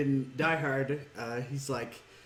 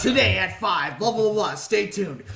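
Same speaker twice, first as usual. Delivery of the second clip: loud, high newscaster sign-off; mock the TV reporter; news anchor voice; 6 semitones higher, 7 dB louder, slower than usual. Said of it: "And then he goes".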